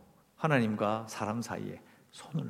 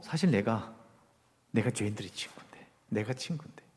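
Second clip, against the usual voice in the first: softer and lower, musing to oneself; quiet inner thought; 5 semitones lower, 7 dB quieter than usual. Acted. God reflecting on being sinners' friend, quietly. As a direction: think aloud gently, voice dropped. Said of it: "생각하신 거죠".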